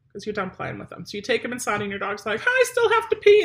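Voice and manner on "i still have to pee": high, loud voice of an eager dog; puppy voice. Pretend. Trainer voicing the dog's reply to its owner, high and loud. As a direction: squeak it out like the desperate dog.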